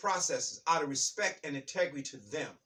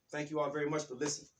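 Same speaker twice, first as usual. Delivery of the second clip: ordinary delivery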